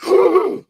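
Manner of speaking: sharp high gasp of horror; horrified gasp. Retold or imitated. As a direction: suck in air as if shocked. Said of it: "like, horrified".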